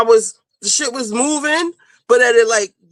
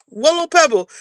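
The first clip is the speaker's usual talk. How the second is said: usual speaking voice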